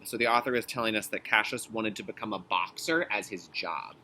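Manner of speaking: ordinary talk, nothing marked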